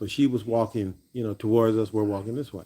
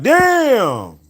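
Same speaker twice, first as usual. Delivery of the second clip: pitched-up, louder amazed damn; impressed high damn; 9 semitones higher, 6 dB louder than usual. Retold or imitated. Colleague marvelling at his eyes, high amazed damn. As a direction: gush it out, wide-eyed.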